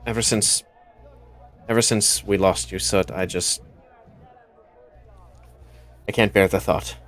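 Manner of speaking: a hint of panic under the apology; worried and quiet. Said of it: "the worry is still there".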